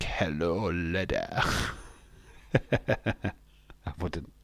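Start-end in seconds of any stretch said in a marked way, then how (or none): 0.0-1.4 low, soft comic hello; mock greeting. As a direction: drop the voice low and quiet for the greeting. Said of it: "I'd be like".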